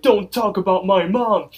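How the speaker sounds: high, loud indignant outburst; acted angry yell. Muscle Man answering the wizard's taunt about his mom, acted angry yell. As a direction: yell it like a defensive son.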